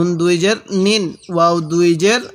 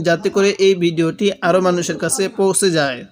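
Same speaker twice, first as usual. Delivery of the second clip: usual speaking voice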